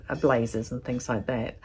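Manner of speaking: usual speaking voice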